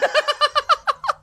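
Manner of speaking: shrieking burst of laughter; loud high laugh. Real, not acted. Cracking up at the friend's ice cream demand, loud laugh. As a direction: howl with laughter.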